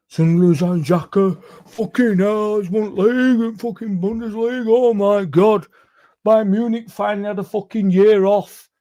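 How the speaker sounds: high, loud, hammed-up raving fan; ballistic fans. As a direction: play the fans going ballistic over a signing. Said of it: "You'd have all gone ballistic if we'd have signed Xhaka".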